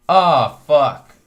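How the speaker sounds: retold loud oh fuck, half-shouted; startled loud outburst. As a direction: blurt it out loudly as the friend who just realized his mistake. Friend realizes he forgot water.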